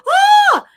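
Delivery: high, loud thrilled shriek; excited scream. Real, not acted. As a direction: shriek with delight at the news. Excited about finished book.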